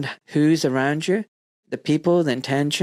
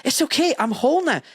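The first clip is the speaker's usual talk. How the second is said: much higher, a rush of tearful joy; joyful release; 12 semitones higher than usual. Retold or imitated. Crying of happiness as his inner child melds into him, high and elated. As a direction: let it burst out as happy relief. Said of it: "changed to crying of happiness".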